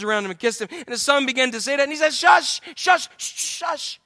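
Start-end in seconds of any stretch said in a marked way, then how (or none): 2.1-4.0 fond hushing. Retold or imitated. Father hushing returning son.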